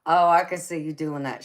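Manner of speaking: ordinary delivery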